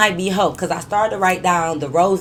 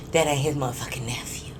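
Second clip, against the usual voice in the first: secret whisper, softer; 4 semitones lower, 6 dB quieter than usual. Real, not acted. Telling viewers the juicy secret in a whisper. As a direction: spill the scandal in a hush.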